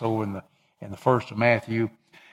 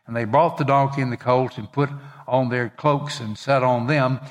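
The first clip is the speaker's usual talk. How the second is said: raised, earnest Bible reading voice; scripture reading; 3 semitones higher, 3 dB louder than usual. Acted. Pastor reading the Gospel to his congregation, raised earnest reading. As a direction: read the Gospel out to the church with fervour. Reads Matthew aloud to congregation.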